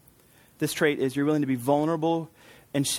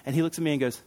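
ordinary delivery

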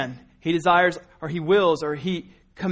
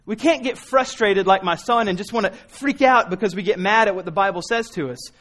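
ordinary delivery

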